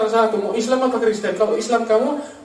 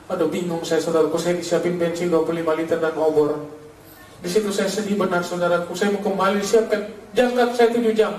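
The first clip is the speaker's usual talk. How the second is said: usual speaking voice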